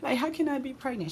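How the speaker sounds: high-pitched mock-tearful protest; imitated crying. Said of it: "I'm just crying".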